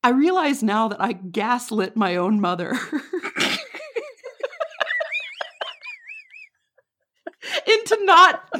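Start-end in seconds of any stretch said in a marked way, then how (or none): 2.7-8.5 words squeezed out through laughter; loud, laughing, nearly crying. Real, not acted. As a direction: laugh helplessly as you confess. Laughing at own confession.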